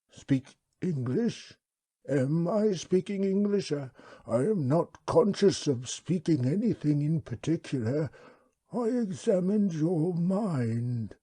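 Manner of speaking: slow, high, unhurried alien creature voice; wub's voice. Acted. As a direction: speak as a placid, courteous old beast. The wub speaks.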